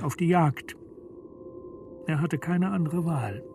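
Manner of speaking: ordinary delivery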